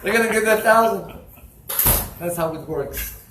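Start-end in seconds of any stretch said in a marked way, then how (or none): 0.0-1.2 high, loud gleeful cry; joyful exclaim. Retold or imitated. Rabbi telling the collector his joy at the scream, loud and gleeful. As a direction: burst out with delight.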